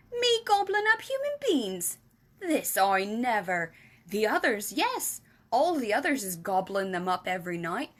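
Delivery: lightly voiced giant, earnest and a bit indignant; big friendly giant protesting his innocence. Giant telling Sophie he eats no one, lightly voiced earnest giant.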